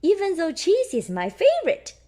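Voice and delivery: high little mouse voice; high mouse voice joking; Mandy's voice. Acted. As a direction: add it with a playful sigh. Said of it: "says Mandy Mouse".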